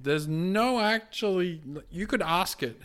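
normal talking voice

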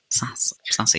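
a soft whispered joke; whispered aside. Real, not acted. Host whispering the pun to his co-host, whispered aside. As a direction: whisper the pun under the breath.

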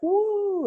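high-pitched mock "woo"; long, high whoop imitating an excited congregation; imitated crowd whoop. Congregation cheering the preacher, mock excited whoop. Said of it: "People are like".

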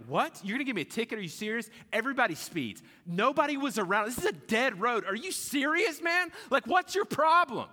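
high, loud, incredulous protest; going off on someone. Acted. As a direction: rant indignantly at the cop, building to a shout. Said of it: "If I start going off like that".

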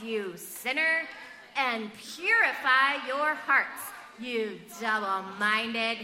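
slow, solemn Bible reading; scripture reading. Acted. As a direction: read each phrase slowly and weightily. Reads the verse aloud.

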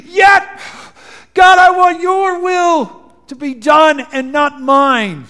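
crying out. Acted. Jesus crying out to God in anguish, loud and high. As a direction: cry out to the Father with everything. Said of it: "he cried out".

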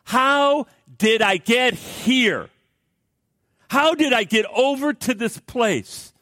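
high, slow, loud cry of despair; anguished outcry. Retold or imitated. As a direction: wail it out like she woke up lost. Woman voiced crying out in despair.

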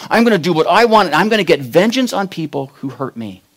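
angry Samson swearing vengeance on those who hurt him; vengeful defiance. Retold or imitated. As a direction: spit out the vow of revenge.

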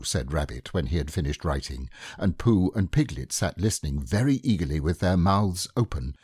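ordinary delivery, nothing marked

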